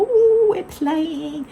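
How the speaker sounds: high, very slow cutesy voice for the puppy; voicing the puppy, sweet and drawn out. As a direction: coo it like the puppy talking. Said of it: "and go".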